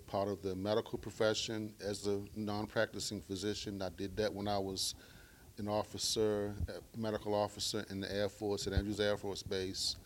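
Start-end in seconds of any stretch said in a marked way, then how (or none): none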